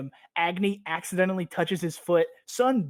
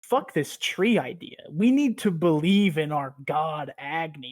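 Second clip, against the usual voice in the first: mock fanatic rant; 7 dB louder than usual. Sun's fanatical rant, retold.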